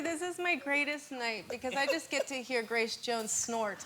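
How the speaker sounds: ordinary talk, nothing marked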